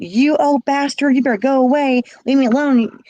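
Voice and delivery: high, loud cry to go away; yelling at abuser. Retold or imitated. Girl yelling at her abuser through the door, loud and high. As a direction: shout him off in terror. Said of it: "I go, I said".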